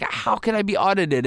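a lightly voiced indignant taxpayer; mock outrage. Imitating shocked tax cheat.

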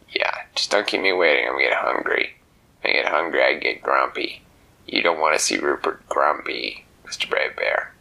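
low, pushy tough-guy bird; gruff flamingo voice. Acted. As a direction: growl it like a grumpy little bird. Flamingo Rupert in story.

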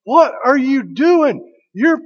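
a hammed-up cartoon dad aghast; Hank Hill gasping. Dad catching the neighbor kid mowing, mock shocked. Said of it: "he gasps and he goes".

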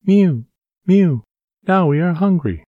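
mewing cats. Acted. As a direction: mew like a crowd of thirsty kittens. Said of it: "said the hundreds of cats".

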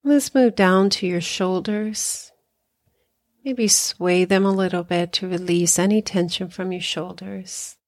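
unhurried meditation guide with long pauses; guided meditation. Acted. Hypnotherapist guiding listeners through body relaxation, calm guided meditation. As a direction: ease them through breathing and relaxing, leaving space after each phrase.

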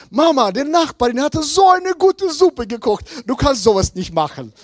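a light hint of children gushing; kids praising neighbour.

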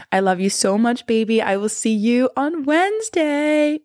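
high, slow loving goodbye; sweet nothings. Real, not acted. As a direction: blow them a kiss goodbye. Affectionate sign-off.

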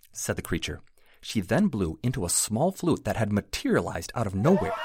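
usual speaking voice

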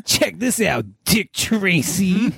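dopey impression, name dragged right out; slow, dumb-guy movie quote; slow stupid voice. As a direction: imitate a dim guy, stretching the last words. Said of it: "like, this, like, slow".

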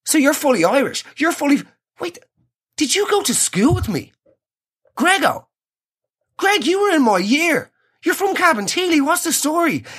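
sudden recognition. Acted. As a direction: realise the waiter went to your school. Recognises waiter as old classmate.

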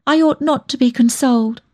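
high, unhappy self-reproach; miserable. A narrator's reading. Young woman refusing consolation from her lover, miserable and high. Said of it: "I said miserably".

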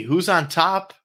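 drawn-out rising segment title; announcer call. Acted. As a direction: announce it like a show host.

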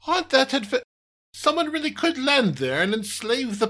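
high cut-off disbelief; can't believe it; incredulous alien boss. Acted.

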